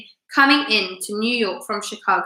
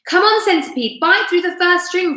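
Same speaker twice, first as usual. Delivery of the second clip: put-on raised commanding boy's voice; James ordering; 8 semitones higher, 4 dB louder than usual. A narrator's reading. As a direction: order it out, high and eager. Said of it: "James ordered".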